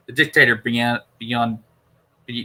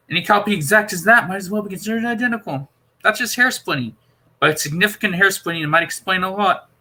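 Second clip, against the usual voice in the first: higher, eager Doctor voice, puzzled and insistent; 7 semitones higher than usual. Acted. Doctor explaining the console to Perry, puzzled and insistent. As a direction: play the Doctor working it out aloud.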